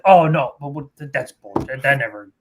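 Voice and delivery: normal talking voice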